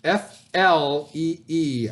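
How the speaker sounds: F L E E spelled letter by letter, slowly; spelling out slowly. Shown as a demonstration. Teacher spells the word for students, slowly. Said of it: "you guys read it".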